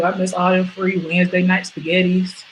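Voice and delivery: usual speaking voice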